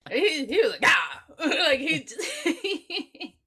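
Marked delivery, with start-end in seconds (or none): 0.8-1.2 sudden loud mock "Ah!"; short, loud jump-scare shout; jump-scare yell. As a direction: burst out with a startling imitation yell. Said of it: "did a jump scare with his voice".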